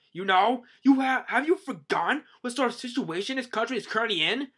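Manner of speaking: loud, high, indignant telling-off; hammed-up scandalized official; acted scolding yell. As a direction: berate the king in outrage. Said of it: "Neptune gets yelled at by some guy".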